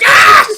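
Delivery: high, loud fan scream; hammed-up "ah" at full volume; reenacted scream. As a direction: shriek as if overwhelmed by the show. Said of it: "the whole time I'm just like".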